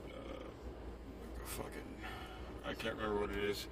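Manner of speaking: breathless, quiet, words trailing; winded after the climb; out of breath. Real, not acted. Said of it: "I'm out of breath and".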